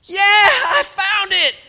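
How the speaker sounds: high, loud, drawn-out cry of joy; child thrilled by cardboard packing, shouting with joy; child's excited shout. Retold or imitated. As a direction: shout it like a thrilled kid who found the treasure. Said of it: "all of a sudden they go".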